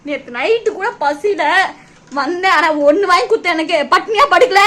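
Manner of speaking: loud, tearful protest rising in pitch; crying complaint. Real, not acted. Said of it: "எதுக்குடி அழுதுட்டு இருக்கீங்க?". (அழுதுகொண்டே சத்தமாக புலம்பல்)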